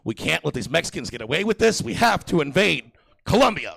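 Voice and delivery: loud, rising presidential declaration; hammed-up national address; mock presidential statement. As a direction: declare it like a president, building to a climax. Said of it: "the president ... has to come out and make a statement".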